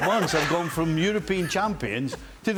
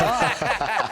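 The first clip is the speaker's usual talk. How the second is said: stunned ah; 6 semitones higher than usual. Retold or imitated. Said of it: "and I'm like".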